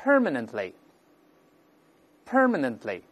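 "permanently" slow, stress on the first syllable; teacher's slow, drawn-out model of "permanently", front-stressed. Shown as a demonstration. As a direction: drill "permanently" slowly, leaning on "per". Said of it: "英式、 美式发音都是重音在前面".

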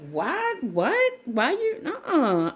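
high, incredulous mock protest; indignant what. Guarded viewer refusing a relative, high and incredulous. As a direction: fire off the questions high, like someone in disbelief. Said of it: "You like".

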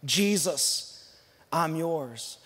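soft, low whispered-close prayer; a quiet sinner's prayer to Jesus. Shown as a demonstration. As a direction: pray it gently and hushed. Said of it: "simply say something like this".

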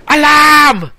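very high, loud shout; drawn-out warning cry; alarm call. Pretend. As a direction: yell it out like a panicked sentry. Raises the alarm in game.

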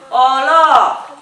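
mock impressed hola. Neighbour reacting to a baby boy, loud mock awe. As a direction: exclaim like it's big news. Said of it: "Cambia el tono completamente".

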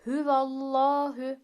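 slow recitation with "Hüvallahu" and Allah in thick, full tone; heavy Allah recitation. Shown as a demonstration. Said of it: "kalın okuyoruz". (Allah lafzı kalın, yavaş tilavet)

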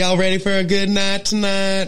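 half-sung Southern hype; a Southern emcee revving up the crowd; Southern accent. Pretend. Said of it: "it's real hard to keep up that accent".

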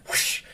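imitated swish of a blade; whoosh sound effect. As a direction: voice the sword swing as a breathy whoosh. Narrating a sword slash.

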